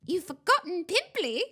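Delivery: high, loud poltergeist jeer; taunting hiss. A narrator's reading. Poltergeist goads the crying ghost, hissing. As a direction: needle her with a nasty taunt. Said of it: "Peeves hissed in her ear".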